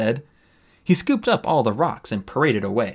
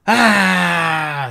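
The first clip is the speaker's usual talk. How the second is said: loud, higher hammed-up howl; dying howl; 7 semitones higher, 7 dB louder than usual. Sunny pretending to die, loud hammed-up howl. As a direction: howl as if struck through the heart. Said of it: "Sunny howled".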